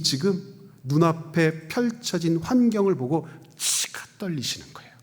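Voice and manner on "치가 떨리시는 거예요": hushed seething. Real, not acted. Preacher describing Jesus' fury.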